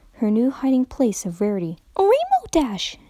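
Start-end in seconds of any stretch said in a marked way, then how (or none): 2.0-3.0 high, drawn-out excited cry of a name; giddy pony bursting out with a friend's name; exclaiming Pinkie Pie. Acted. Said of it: "exclaimed Pinkie".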